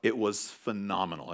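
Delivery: normal talking voice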